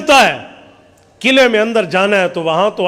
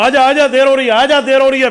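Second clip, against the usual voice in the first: higher and louder, hurrying someone along; urging call; 6 semitones higher, 4 dB louder, faster than usual. Retold or imitated. Gatekeeper hurrying the man through the closing gate. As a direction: wave him in, it's getting late.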